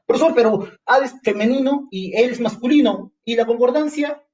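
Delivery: high, puzzled student voice; objecting student. Acted. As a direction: play the student who protests. (estudiante que protesta con voz aguda)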